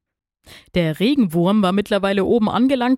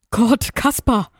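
higher, drawn-out worried exclamation; anxious; 6 semitones higher, 3 dB louder, slower than usual. A narrator's reading. Worm's wife frets over her departing husband, anxious. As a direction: fret over a husband, slowing the words. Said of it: "ängstigte sich die Regenwürmin".

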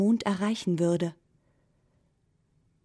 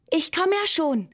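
higher, louder eager call; 9 semitones higher, 5 dB louder than usual. A narrator's reading. Pencil answering the moon, calling out higher and louder. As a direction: call back eagerly, already on the way. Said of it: "rief der Bleistift".

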